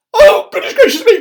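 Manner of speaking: very high, loud panicked squeal; cried out. Acted. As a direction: shriek like a flustered lady. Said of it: "cried Missus Jelly".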